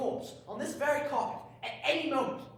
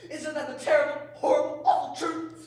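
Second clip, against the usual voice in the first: mounting dramatic lament; 3 semitones higher, 8 dB louder than usual. Acted. Morbid drunk rant building.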